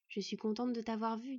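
ordinary delivery, nothing marked

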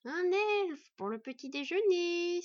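high, slow, relishing monster voice; hungry spider gloating to its partner, high and slow; gleeful spider. A narrator's reading. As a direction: savour every word like a hungry spider. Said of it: "se réjouit la deuxième arachnide".

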